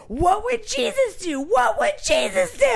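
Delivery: angry driver screams at her, mock rant; mock screaming preacher. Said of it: "he starts screaming".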